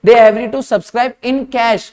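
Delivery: slow, emphatic read-out; deliberate teacher's reading of exam text; careful reading. Shown as a demonstration. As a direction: read it out word by word, landing on the last words. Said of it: "ध्यान से पढ़ो".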